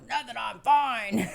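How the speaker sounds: loud, drawn-out mock insistence; defensive denial. Sick husband brushing off worried wife, hammed-up denial. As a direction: imitate a stubborn denial, high and slow. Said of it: "that was the tone of voice he had".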